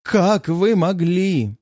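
milk the dying hero scene; hammed-up tragedy. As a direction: narrator faking a dying farewell to the cruel world. Narrator fakes tragic death scene.

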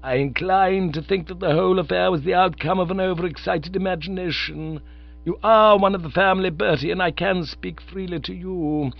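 a lightly voiced stern, formal aunt; Aunt Agatha's voice. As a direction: read it as a prim aunt laying down the law. Aunt Agatha hesitates over uncle's drinking.

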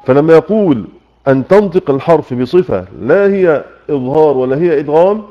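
ordinary talk

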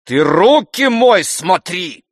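high, loud, drawn-out angry rebuke; spiteful. A narrator's reading. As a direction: shout it slowly and furiously at the servant. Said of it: "злобно сказал Обломов".

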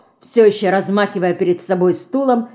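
plain reading voice

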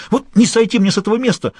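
a scared small-time crook blurting it out; a cornered accomplice answering the gang boss, high and nervous; frightened petty thief. A narrator's reading.

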